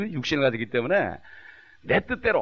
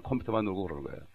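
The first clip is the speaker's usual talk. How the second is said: ordinary talk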